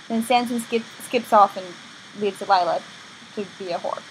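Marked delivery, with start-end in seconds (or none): none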